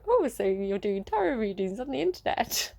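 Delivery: a made-up voice for her dad, not his real one; put-on dad voice. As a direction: mimic dad on the phone in a silly voice. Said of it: "That's not his voice".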